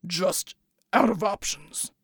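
very low, slow, strained rumble; deep slow voice. A narrator's reading. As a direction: drag it out, deep and heavy. Bull's voice.

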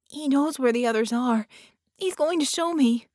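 put-on high, loud little girl's urgency; a child pulling you along; urgent. A narrator's reading. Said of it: "the child said urgently".

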